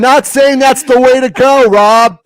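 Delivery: loud defensive outburst at Rob; exasperated shout. Real, not acted. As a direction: push back hard on Rob. Heated protest at his friend.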